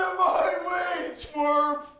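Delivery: high, loud nerd-bully yell; Ogre. Acted. Comic opening the set as Ogre, yelling. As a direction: bark it like a jock bully. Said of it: "I'm Ogre from the Revenge of the Nerds".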